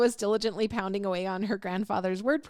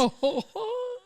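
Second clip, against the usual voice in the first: high laugh; 14 semitones higher than usual. Real, not acted. Laughing at joke.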